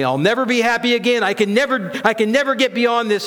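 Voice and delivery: high, hurried hopelessness; despairing worldly voice. Acted. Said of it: "My worldly voice tells me".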